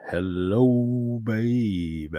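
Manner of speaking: drawn-out put-on greeting; long, lingering catchphrase call. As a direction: stretch both words out in a silly voice.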